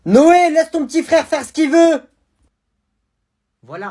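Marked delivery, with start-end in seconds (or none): 0.1-2.0 mother yelling. Acted. Mother yelling at older son.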